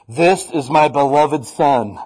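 slow, loud, booming declaration; a father's voice booming out from heaven. Retold or imitated. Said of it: "out loud goes".

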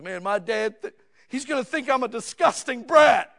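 loud, high mock wail of a kid pretending to cry; fake tearful shout. A boy fakes tears and yells at the detective, in a high mock wail. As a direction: ham up a fake sob and yell it out high. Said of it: "here's the exact words he said".

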